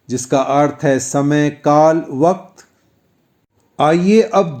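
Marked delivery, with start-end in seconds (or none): none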